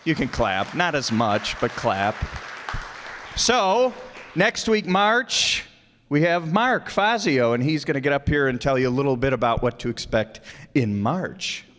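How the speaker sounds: normal talking voice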